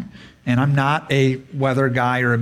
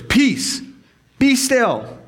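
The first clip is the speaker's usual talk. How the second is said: commanding voice; 9 semitones higher, much slower than usual. Retold or imitated. Jesus calms storm, imagined scene.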